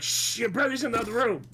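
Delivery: high, urgent mock shush; imitated parental hushing. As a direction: hush a child in high-pitched alarm. Parent hushing a swearing son.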